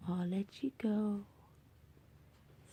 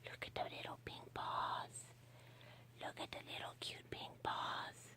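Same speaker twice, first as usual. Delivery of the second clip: much softer hushed whisper; 12 dB quieter than usual. Real, not acted. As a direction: barely breathe it over sleeping paws. Whispering over the paws.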